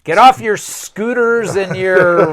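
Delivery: play-yell at the youngsters, slowly; mock yelling. Co-host joining the rant at young voters, mock yelling.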